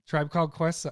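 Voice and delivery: [name] said loud and bright with Called stressed; excited exclaim. Shown as a demonstration. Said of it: "say the whole thing like".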